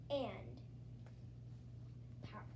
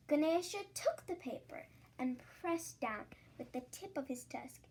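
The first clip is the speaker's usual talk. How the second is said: usual speaking voice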